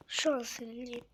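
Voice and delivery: voiceless whisper, "çok" stressed; whispered anger. Real, not acted. Fan whispers to viewers how angry the loss made him.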